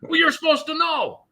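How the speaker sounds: high-pitched urgent worker voice; anxious warehouse worker. Retold or imitated. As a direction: play the jittery guard warning him off. Worker's voice in retold story.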